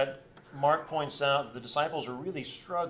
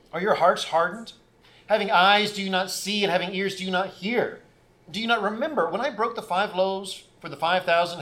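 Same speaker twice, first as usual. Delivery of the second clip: raised, declaiming Bible reading voice; louder public scripture reading; 3 semitones higher, 4 dB louder than usual. Acted. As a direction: read the passage out to the congregation with weight. Reads the Gospel passage aloud.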